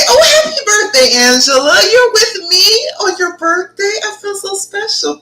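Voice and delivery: high, loud gushing birthday wishes; gushing happy birthday to Angela; excited birthday greeting. Real, not acted. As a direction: squeal the birthday wishes to her.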